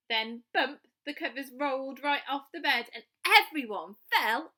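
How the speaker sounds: put-on lively read-aloud for children; storytelling. A narrator's reading. Storyteller reading a picture book aloud to young children. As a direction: tell the bedtime story with bright expression.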